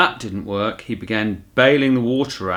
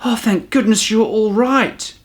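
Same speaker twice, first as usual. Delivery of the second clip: cried out; 12 semitones higher, 4 dB louder than usual. Acted. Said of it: "cried Judy".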